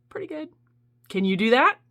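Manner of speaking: ordinary delivery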